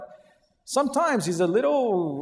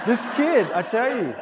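laughing; 5 semitones higher, 3 dB louder than usual. Real, not acted. Laughing about son.

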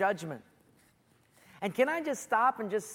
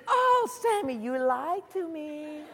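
high, drawn-out whine; hammed-up wounded sweetheart; wheedling mock hurt; 5 semitones higher, slower than usual. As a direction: pout it, stretching the words. Delilah whining at being tricked.

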